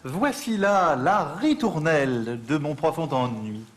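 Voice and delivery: slow, sung-out poetry reading; poem recital. Acted. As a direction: recite it slowly like verse.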